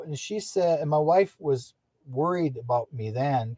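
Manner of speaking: normal talking voice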